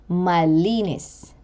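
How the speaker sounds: malinis slowly, stress on the middle -li-; penultimate stress. Shown as a demonstration. Teacher repeating a penultimate example, long middle syllable in malinis. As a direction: draw out the -li- in malinis. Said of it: "given to the syllable before the last".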